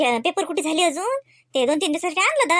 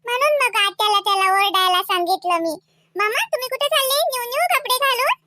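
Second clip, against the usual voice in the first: put-on high, childlike voice; little niece; 9 semitones higher than usual. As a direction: complain and question like a small girl. Little niece chats with uncle.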